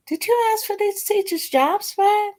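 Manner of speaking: high, disbelieving question from the bench; incredulous judge. Retold or imitated. Retold judge questioning the attorney.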